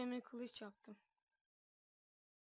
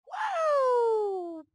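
high, loud wow cry; surprised shout; 12 semitones higher, 11 dB louder than usual. Real, not acted. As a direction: yelp in sudden surprise. Oyunda şaşkın çığlık. (şaşkın çığlık)

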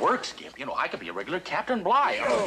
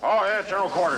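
practice command with more push; belly behind it; 2 semitones higher, 4 dB louder than usual. Shown as a demonstration. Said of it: "Put some belly behind it".

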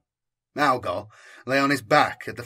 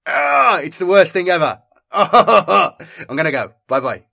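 louder, higher, laughing exasperation; laughing groan; 6 semitones higher, 11 dB louder than usual. Real, not acted. Craving a cigarette, laughing.

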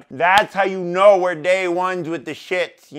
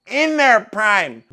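slower louder repeat; 4 semitones higher, 7 dB louder, slower than usual. Shown as a demonstration.

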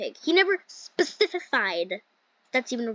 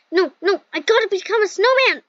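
loud, high mock-desperate shout; playful urgent cry; 8 semitones higher, 7 dB louder than usual. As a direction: cry it out higher and louder, in play. Urgent play-acting in game.

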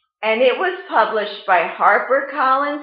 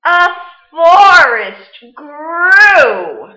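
story reading; 7 dB louder than usual. Acted. Teacher reading Where the Wild Things Are to the class, storytime voice. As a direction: read it like storytime, letting big moments swell. Teacher reads picture book aloud.

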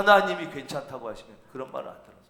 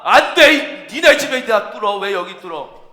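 high, loud burst of objection; indignant refusal; 4 semitones higher, 6 dB louder than usual. Acted. Homeowner refusing to let his roof be opened, indignant. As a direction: stop them with an outraged cry.

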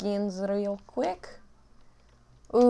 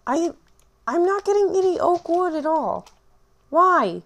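frustrated outburst; 10 semitones higher, 5 dB louder than usual. Real, not acted. Frustrated at missing oak.

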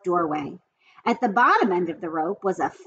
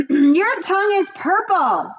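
high, slow, louder scream; 9 semitones higher, 5 dB louder than usual. A narrator's reading. Fern screaming at Avery on the swing, high and loud. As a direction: scream it across the barn. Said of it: "screamed Fern".

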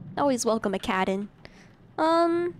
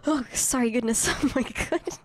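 ordinary talk

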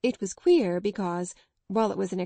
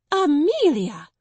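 loud, high furious cry of the name; infuriated gasp; 7 semitones higher, 9 dB louder, slower than usual. Acted. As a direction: explode with outrage at her sister. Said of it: "gasped her infuriated elder".

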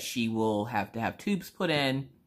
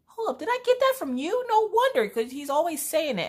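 sudden realization. Retold or imitated. Realizing where phrase came from.

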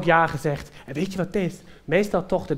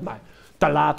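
ordinary talk, nothing marked

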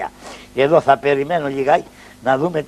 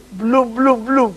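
silly noise; 5 semitones higher, much slower than usual. Acted.

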